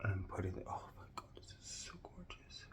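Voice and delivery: breathless whisper of awe; hushed, amazed whisper; awed whisper. Real, not acted. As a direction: whisper it like you can't believe it. Awed whisper over makeup.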